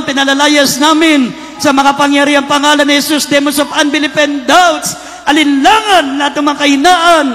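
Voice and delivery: loud shouted deliverance prayer; preaching at full force. Acted. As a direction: cast demons out with full force.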